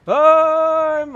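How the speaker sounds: sudden loud, high yelp; startled yell. Real, not acted. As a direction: yelp as the character drops dead. Streamer's character suddenly dies.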